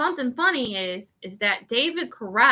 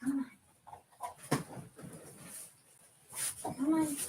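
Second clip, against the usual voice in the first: high and much softer "Come on"; soft coaxing; 4 semitones higher, 16 dB quieter than usual. Real, not acted. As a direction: breathe "Come on" gently to the dog. Calling the dog again quietly.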